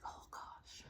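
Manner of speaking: a private, breathless oh gosh; mock worried whisper; whispered inner thought. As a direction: whisper it to yourself, half alarmed. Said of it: "And I'm like".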